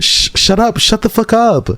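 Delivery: very high mock-angry singer voice; very high mock pop-star tantrum. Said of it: "give [name] acting".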